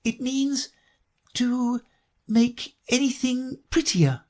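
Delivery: high, halting definition; Alice. A narrator's reading. Alice defines beautify for the Gryphon, doubtful and halting. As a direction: feel for each word uncertainly, with pauses. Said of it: "said Alice doubtfully".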